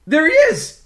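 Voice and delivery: high, loud cry of discovery; excited shout. A narrator's reading. Mac spots his lost friend, excited shout. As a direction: shout it out, pitch leaping up. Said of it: "Mac shouts".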